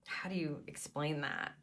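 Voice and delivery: soft whispered aside to herself; a hushed question to herself while searching for the word. Real, not acted. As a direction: breathe it under the breath, thinking aloud.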